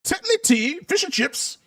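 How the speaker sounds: put-on high-pitched caricature British accent. Host listing British clichés, mock British accent. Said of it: "That's the best British accent I can come up with".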